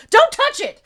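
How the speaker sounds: high, loud warning shout; mock yell. Host imitating the priest warning the others, mock yell. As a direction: yell it like a shocked priest, far up in pitch. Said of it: "he yells at them".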